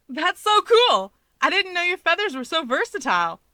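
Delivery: put-on an amazed teen gushing about the feathers; excited.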